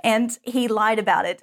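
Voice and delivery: ordinary talk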